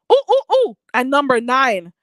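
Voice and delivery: ordinary talk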